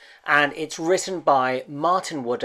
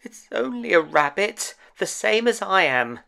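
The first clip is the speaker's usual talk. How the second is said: higher small toy voice, talking himself into courage; a little rabbit steadying his nerve; Tom Rabbit reassuring himself; 8 semitones higher than usual. Acted. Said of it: "thought Tom Rabbit".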